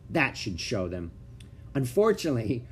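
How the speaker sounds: ordinary delivery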